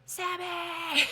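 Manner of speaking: high, held-back yell after a dog; retold scream. Owner chasing her dog down the river, mock scream. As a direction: imitate screaming for a dog without full volume.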